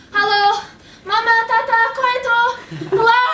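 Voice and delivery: loud, high calls for her parents; calling out. Retold or imitated. Scared girl calling her parents from the locked bathroom, loud and high. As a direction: call out to the house, frightened. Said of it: "calling out in Bosnian".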